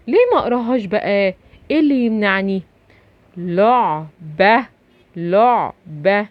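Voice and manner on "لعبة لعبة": لعبة slowly split into two syllables; sounded-out syllables. Shown as a demonstration. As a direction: break لعبة apart to show the opening L. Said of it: "أدي اللام". (نطق لعبة ببطء مقطعًا مقطعًا)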